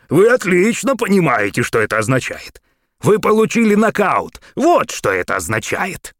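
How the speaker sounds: high, loud triumphant taunt; gloating. A narrator's reading. Gramp gloating over the beaten mayor. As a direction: rub it in.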